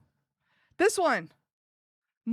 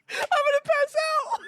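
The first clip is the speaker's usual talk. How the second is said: much higher, louder shout of "I'm gonna pass out"; laughing shout; 5 dB louder than usual. Real, not acted. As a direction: yell it, overwhelmed with laughter.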